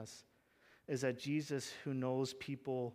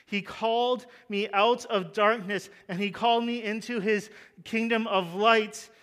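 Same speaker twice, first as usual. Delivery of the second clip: loud, higher pastor's call over the gospel; fervent preaching; 5 semitones higher, 7 dB louder than usual. Acted. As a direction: proclaim it with full conviction. Preaching at full force.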